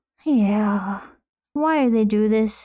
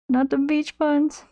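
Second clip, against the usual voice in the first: drawn-out, higher whine of mock despair; 4 semitones higher, slower than usual. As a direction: stretch it out like a pained plea.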